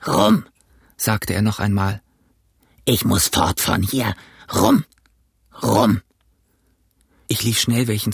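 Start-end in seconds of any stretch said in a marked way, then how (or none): 0.1-0.4 high, forceful rough cry; demanding captain. Acted. Stricken captain demands rum again. 2.8-6.0 desperate captain. Acted. Stricken captain begs for rum.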